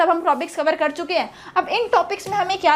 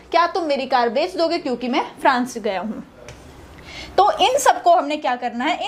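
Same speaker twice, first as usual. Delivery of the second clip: normal talking voice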